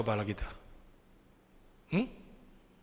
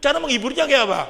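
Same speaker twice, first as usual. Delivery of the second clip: ordinary talk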